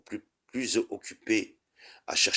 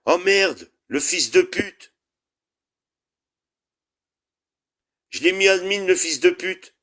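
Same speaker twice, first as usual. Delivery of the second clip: much higher, loud angry swearing; furious shout; 11 semitones higher, 8 dB louder than usual. Real, not acted. Streamer raging after making troll admin, shouting. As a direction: yell at your own blunder.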